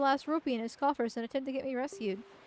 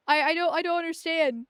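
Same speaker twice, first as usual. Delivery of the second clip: ordinary talk, nothing marked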